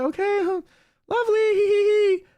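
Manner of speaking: very high sing-song mock cheer with forced giggle; uneasy playing along. Storyteller reacting to the screaming passenger, high mock-nervous cheer. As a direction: squeak out a fake-cheery reaction, ending in hee-hee.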